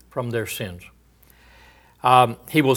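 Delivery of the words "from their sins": quiet echo. Shown as a demonstration. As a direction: echo "From their sins" almost under the breath.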